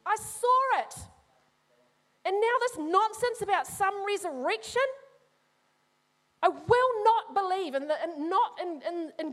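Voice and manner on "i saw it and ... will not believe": bitter doubting Thomas. Acted. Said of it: "it's like he's saying".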